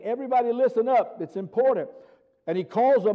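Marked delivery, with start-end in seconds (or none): 0.0-1.9 raised-pitch, brisk call for attention; rally a crowd to listen, emphatically; emphatic. Retold or imitated. Preacher voicing Jesus calling the multitude, emphatic. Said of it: "he's emphatically saying".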